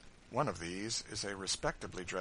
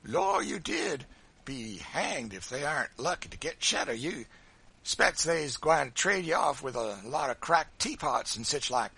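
loud, raised taunting voice; gleeful, goading jeer; provoking grin; 5 semitones higher, 8 dB louder than usual. A narrator's reading. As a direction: needle them with a grin. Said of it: "said Sambo with a provoking grin".

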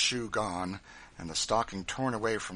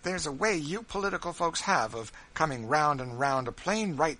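higher, louder, insistent rebuttal; argumentative; 6 semitones higher, 5 dB louder than usual. A narrator's reading. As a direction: press the point home in a raised voice. Character arguing about politics.

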